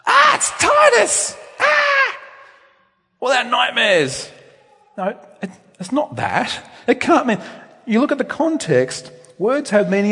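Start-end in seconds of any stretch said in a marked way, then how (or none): 0.1-4.3 shrieking, much higher, slow, hammed-up fright; a comic scream at the sight of Titus; mock terrified shout. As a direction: congregation mocking terror at a visitor, hammed-up shriek. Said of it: "it can't mean that they were scared of Titus".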